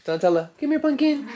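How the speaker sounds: high-pitched pet call; calling the dog. Real, not acted. Owner calling her puppy over, high and coaxing.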